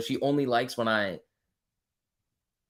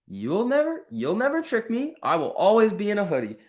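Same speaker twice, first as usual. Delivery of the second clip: playful defiance; 9 semitones higher than usual. Real, not acted.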